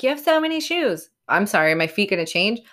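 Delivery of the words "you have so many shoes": higher, lightly mocking husband voice; teasing husband. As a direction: poke fun at his nagging, pitched up. Ryan's always like.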